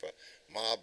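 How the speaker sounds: ordinary talk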